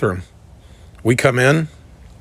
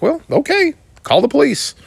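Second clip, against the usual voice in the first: defiant; 13 semitones higher than usual. Retold or imitated. Defiant reply to threat.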